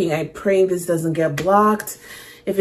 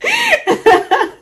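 very high gleeful laugh; giddy squealing laughter; high giggle. Real, not acted. Laughing at own joke.

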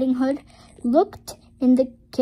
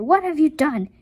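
louder, pitched-up accusing cry; raised voice, demanding an answer; 5 semitones higher, 6 dB louder than usual. A narrator's reading. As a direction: exclaim it in storybook alarm. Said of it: "cried the woodcutter".

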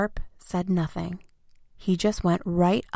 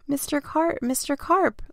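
calling out; 8 semitones higher than usual. A narrator's reading. Boy calls down to Mister Carp, high anxious cry. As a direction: call his name out high and worried. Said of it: "I yelled".

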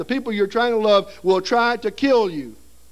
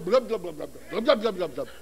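bubbling underwater; 2 semitones lower, 3 dB quieter than usual. Retold or imitated. Boy pulled under by the alligator roll, bubbling and fading. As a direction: mimic sinking under the water.

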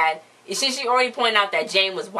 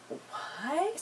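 gasp, then a soft, high, rising question; mock shock; 10 semitones higher, 13 dB quieter than usual. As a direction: act out a stunned, hushed disbelief. Acting a shocked reaction in retelling.

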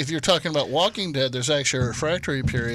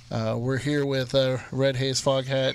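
normal talking voice

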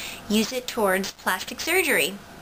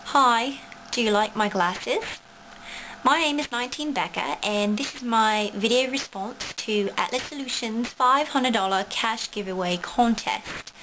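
put-on practicing a British accent, lightly done; amateur British accent; fake British accent. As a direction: try out a British accent for the camera. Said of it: "I'm not really British, this is just an accent that I'm practicing".

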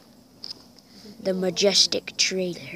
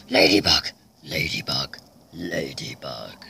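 much lower, slowing, fading ladybug; deep silly voice. Pretend. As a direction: sink the word into a low growl.

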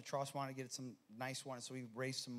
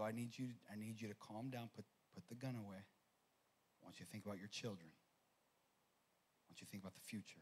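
calming; 4 semitones lower, 7 dB quieter than usual. Retold or imitated. Pastor calms armed man.

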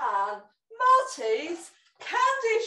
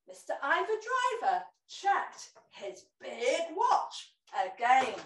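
ordinary delivery, nothing marked

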